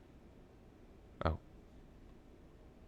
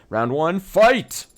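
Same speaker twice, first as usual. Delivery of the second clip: high, loud arena announcer yell; fight announcer; 11 semitones higher, 8 dB louder, much slower than usual. Acted. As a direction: hype it like a fighting game. Announcing cross-examination start.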